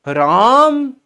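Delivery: राम stretched high and loud; theatrical drawn-out राम; stage-drawn Ram. Shown as a demonstration. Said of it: "खींच के बोल रहे हैं".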